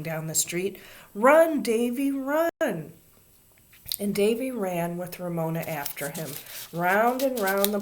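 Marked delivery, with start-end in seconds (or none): 1.1-2.9 high, loud urging cry; shouting. A narrator's reading. Children warning Davy about Ramona, shouting. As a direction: shout him on like excited kids. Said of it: "the other boys and girls shouted".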